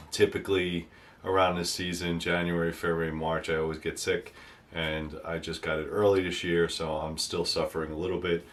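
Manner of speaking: normal talking voice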